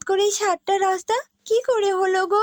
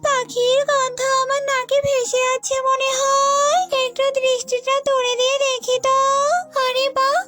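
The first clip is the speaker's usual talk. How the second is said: witch voice; 9 semitones higher than usual. Acted. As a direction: play the witch gloating over her next meal, pitched high. Witch voice in a folk tale. (উঁচু, ধীর ডাইনির গলা)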